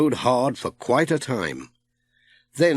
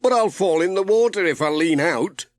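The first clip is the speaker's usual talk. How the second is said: a touch of worry, voiced higher; nervous he will fall into the water; Pat, mildly worried; 7 semitones higher than usual. A narrator's reading. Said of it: "said Pat".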